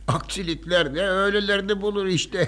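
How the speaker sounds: amused chuckle breaking into the words; laughing. Acted. Father laughs off the tea accident, chuckling. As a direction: chuckle at the mishap, shrugging it off. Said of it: "gülme baba".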